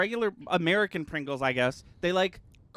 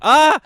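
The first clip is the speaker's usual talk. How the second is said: sudden high startled scream; surprised shriek; 12 semitones higher, 14 dB louder than usual. Real, not acted. Sudden shriek mid-conversation.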